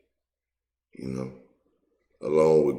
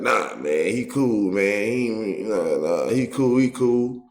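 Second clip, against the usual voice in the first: higher-pitched imitation of someone vouching for a friend; insistent, high mock defense; defensive mimicry; 5 semitones higher, slower than usual. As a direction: mimic them brushing off the warning, pitch climbing. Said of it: "they defending them like".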